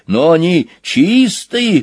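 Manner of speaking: high, slow protest. A narrator's reading. Boar defends his dice, protesting. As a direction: insist on it, drawing the words out high. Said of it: "протестовал Хряк".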